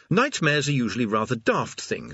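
plain reading voice

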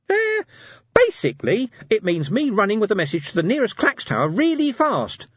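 high, loud, eager little imp; hope he says yes; hopeful imp. Acted. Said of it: "said the imp hopefully".

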